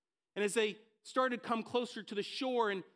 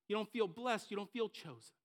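usual speaking voice

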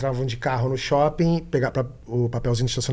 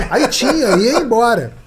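usual speaking voice

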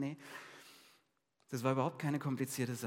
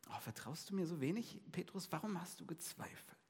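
softer, a tender half-whispered reproach; gentle rebuke; 3 semitones higher, 7 dB quieter than usual. A narrator's reading. Jesus holding sinking Peter, a soft tender rebuke. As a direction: chide him kindly, close up.

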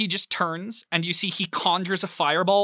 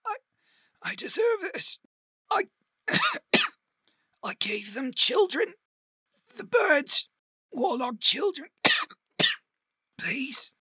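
high, strained voice broken by coughs; wounded man pleading, coughing; coughing dying man; 10 semitones higher than usual. Acted. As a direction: gasp out a confession between coughs. Dying man coughs out his confession.

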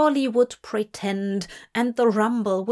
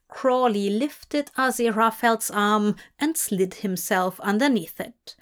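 plain reading voice

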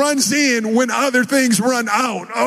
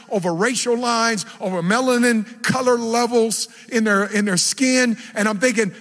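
ordinary talk, nothing marked

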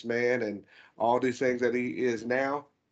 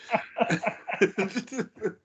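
higher-pitched burst of laughter; amused laugh; 11 semitones higher than usual. Real, not acted. Co-host cracking up over dad joke number two, laughing. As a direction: laugh at your own silly joke.

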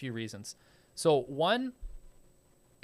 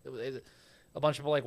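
ordinary talk

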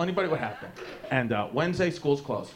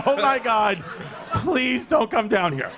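high, laughing, slow pleading; laughing plea; 6 semitones higher, 3 dB louder, slower than usual. Retold or imitated. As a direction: beg through laughter. Begging dad not to come.

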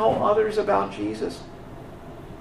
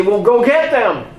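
louder curt command, go get them; impatient boss yelling an order; barked order; 6 dB louder than usual. Retold or imitated. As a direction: snap the order at the guards. Leaders order the guards.

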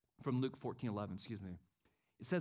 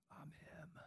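unvoiced inner brag to yourself; whispered inner boast; 19 dB quieter than usual. Preacher asks the church about their hearts, whispered. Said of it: "in your heart going".

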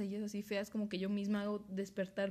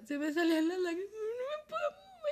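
higher, crying voice breaking, slowing; tearful; 12 semitones higher, 5 dB louder than usual. Retold or imitated. Recalling being paralyzed and crying, tearful breaking voice. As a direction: let the tears come back as you tell it. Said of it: "yo estaba llorando a madres".

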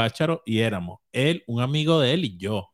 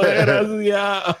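mock indignant; 9 semitones higher, 5 dB louder, much slower than usual. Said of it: "Y yo".

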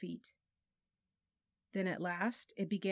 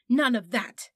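much louder, nasty warning bark; 5 semitones higher, 12 dB louder than usual. A narrator's reading. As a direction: stop her cold, meaner than meant. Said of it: "in a voice even nastier".

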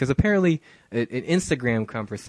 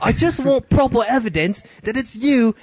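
put-on much higher, louder girlish voice; girl's voice; 11 semitones higher, 4 dB louder than usual. Host reading the girl's texts in a high girl voice.

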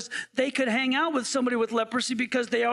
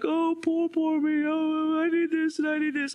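whiny mock pity, a bit higher; mock self-pity; 4 semitones higher than usual. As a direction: mimic a self-pitying poster. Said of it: "they're like".

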